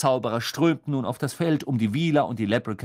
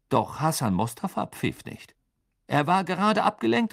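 plain reading voice

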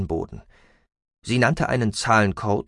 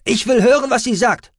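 very high, loud demand; forceful high character voice; insistent shout; 8 dB louder than usual. A narrator's reading. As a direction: shout it over the others from behind. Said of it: "rief Aspartamin aus dem Hintergrund".

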